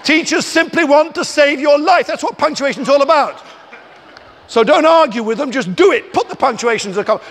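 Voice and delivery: loud, high mock-ranting; loud lecture. Author loudly lecturing pupils about punctuation. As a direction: hammer it home loudly.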